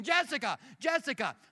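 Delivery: high, loud retold yell of a name; retold frantic scream. Wife joins in, screaming for their missing daughter. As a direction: shout the name as if searching a crowd. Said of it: "She starts screaming".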